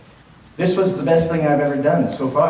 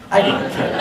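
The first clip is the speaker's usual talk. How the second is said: laughing; 6 semitones higher than usual. Real, not acted. Gleeful about dating expenses.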